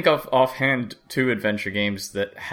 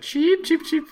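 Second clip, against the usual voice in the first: high squeaky chirps; cartoon bird voice. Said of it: "and so then I said".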